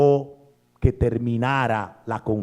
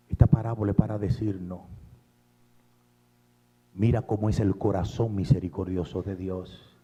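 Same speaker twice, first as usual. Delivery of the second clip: low, soft sermon voice; tender appeal; 5 semitones lower, 4 dB quieter than usual. Acted. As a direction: draw them in gently.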